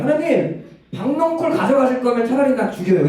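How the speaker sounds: high-pitched hot-tempered complaint; acted outburst, whining up at God; worked-up anger. Preacher voicing Jonah protesting to God over the gourd, worked-up anger. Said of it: "막 열받아서 하나님한테 얘기해요".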